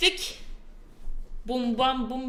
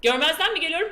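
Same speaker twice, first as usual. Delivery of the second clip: ordinary delivery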